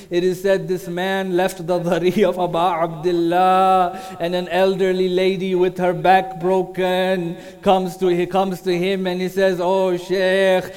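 rising grief-laden recitation that swells into near song; mournful majlis lament. Acted. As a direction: chant the tragedy so the mourners weep. Majlis lament narration building.

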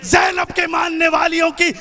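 ringing, forceful rally oratory; impassioned preaching. Real, not acted. Vowing to protect the women of the community, impassioned shout. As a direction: build a defiant vow, drawing out the key words.

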